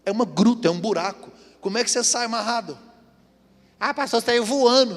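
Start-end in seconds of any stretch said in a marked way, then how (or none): 3.8-5.0 higher, teasing listener voice; mock the listener's answer; a hint of a cheeky listener.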